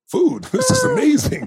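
put-on high, squealing kid delight with a laugh; boy thrilled about Thanksgiving food, squealing high and laughing; kid's excitement. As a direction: play the thrilled child, voice cracking high. Kid's joy over food.

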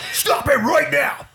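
high, slow loud shout; shouted command. Retold or imitated. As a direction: stop the dog dead. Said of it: "So I turn".

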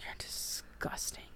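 slow, whispered revulsion; disgusted whisper. Acted. Woman recoiling from gloating killer, whispered. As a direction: push the words out in disgust.

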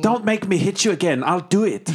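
impatient threat. Acted. Interrogator to a stalling prisoner, impatient warning. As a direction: threaten him with the pitch pushed up.

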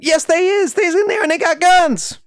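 put-on high, loud excited kid voice; young boy shouting. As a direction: yell it like an eager little boy. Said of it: "the young boy went".

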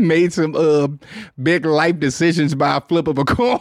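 laughing through the words. Real, not acted. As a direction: chuckle while admitting it. Laughs at own admission.

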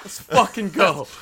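loud, drawn-out hyped-up cheer; excited cheer. Retold or imitated. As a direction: cheer it like a fired-up fan. Retold reaction, cheering on singer.

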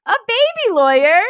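put-on pitched high and loud, "A baby lawyer?" squealed out with delight; squealing baby lawyer. Host cooing at the listener's baby lawyer line, high squeal.

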